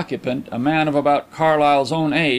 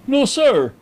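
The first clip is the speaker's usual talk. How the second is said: high, louder, stiff reply; 12 semitones higher, 5 dB louder than usual. A narrator's reading. Visitor answers after a flash of annoyance, stiff. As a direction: answer stiffly, with offended formality. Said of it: "he replied stiffly".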